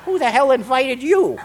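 high, loud mock outrage; hammed-up indignant challenge; indignant comeback. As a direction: snap at an unwelcome voice. Snaps back at inner voice.

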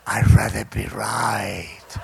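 slow, loud, low-pitched growling answer; defiant retort. Retold or imitated. Newcomer answering the old-timer's question, loud low defiance. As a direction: dig your heels in, low and drawn out.

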